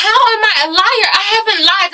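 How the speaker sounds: high-pitched mock protest; indignant denial. Asha answering German who called her a liar, mock indignant. Voicing Asha denying the accusation.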